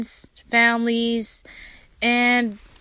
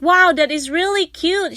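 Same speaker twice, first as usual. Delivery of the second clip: admiring; 6 semitones higher than usual. Retold or imitated. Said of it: "And I was like".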